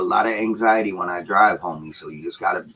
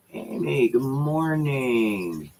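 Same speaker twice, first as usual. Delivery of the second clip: slow, stretched, higher good morning; drawn-out greeting; 6 semitones higher, much slower than usual. Real, not acted. Greeting viewer Amy on stream, slow stretched and higher. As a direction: sing it out slowly to a viewer.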